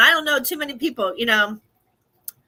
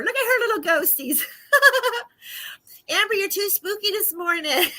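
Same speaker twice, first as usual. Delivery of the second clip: laughing tease; 10 semitones higher than usual. Real, not acted. Teasing Amber's ghost costume.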